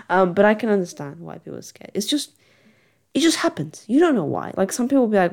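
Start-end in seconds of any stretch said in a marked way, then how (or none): none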